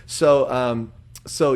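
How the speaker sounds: ordinary talk, nothing marked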